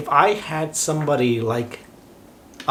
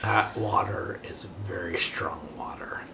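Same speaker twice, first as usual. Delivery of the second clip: whispering; 8 semitones lower, 10 dB quieter than usual. Real, not acted. Whispered aside about alcohol.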